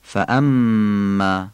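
فاما very slow, glottal stop on the hamza, doubled nasal م held; slow recitation demo of فاما. Shown as a demonstration. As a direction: lean on the stop, then hum the doubled م in فاما. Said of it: "نبر همزه و غنهٔ میم".

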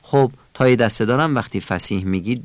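normal talking voice

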